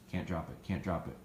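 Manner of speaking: low and soft, an under-the-breath reminder to the dog; soft repeated reminder. Shown as a demonstration. Trainer reminding the retrieving dog to hold, low and soft. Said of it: "so I say hold to remind them".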